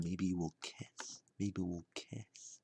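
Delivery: soft, slow, drifting off; dreamy hush. Pretend. Imagining a first kiss on the date, dreamy hush. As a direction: sink into a hushed daydream, slowly.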